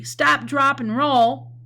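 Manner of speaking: high, loud panicked commands; loud, hammed-up alarm; scared shout. Shown as a demonstration. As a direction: yell it like you're scared of the fire. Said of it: "Sometimes it's scary".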